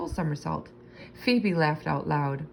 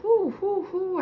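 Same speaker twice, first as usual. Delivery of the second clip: high, quick hamster questions; little hamster asking who; eager hamster squeak; 3 dB louder, slower than usual. A narrator's reading. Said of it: "I asked".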